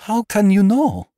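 high, loud outburst of alarm; horrified cry. A narrator's reading. Frightened lady to the doctor who guessed her dreams, crying out. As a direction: cry it out, pitch leaping up. Horrified repeat of whispered question.

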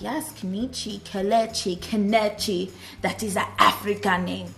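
hammed-up, badly faked African accent. Imagined viewers reacting to her Nigerian name, bad African accent. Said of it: "That was a really bad accent".

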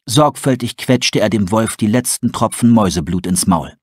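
plain reading voice

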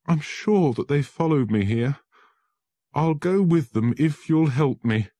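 high, loud, anguished; desperate lawyer begging Holmes for help; desperate plea. Acted. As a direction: plead for help, panicking. Frightened man fears arrest.

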